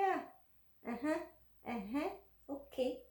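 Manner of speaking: soft, unbothered uh-huhs; softly amused calm replies; calm smiling. Retold or imitated. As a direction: nod along sweetly while he yells. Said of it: "I was very calm".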